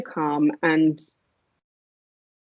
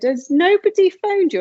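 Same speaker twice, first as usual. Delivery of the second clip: high, slow, alarmed question; a nurse surprised no one has called; startled question; 12 semitones higher, 3 dB louder than usual. Retold or imitated. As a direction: nurse asking the wife, high and worried.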